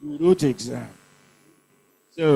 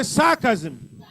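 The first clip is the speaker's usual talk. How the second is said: sarcasm, slow and raised on "sar"; stress on first syllable. Shown as a demonstration. Teacher has class repeat the word, sarcasm stressed on "sar". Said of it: "First syllable".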